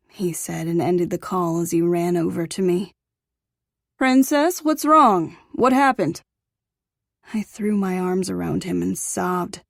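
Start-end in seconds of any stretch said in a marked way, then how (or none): 4.0-6.2 high, loud, alarmed concern; worried father. A narrator's reading. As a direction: rush over worried.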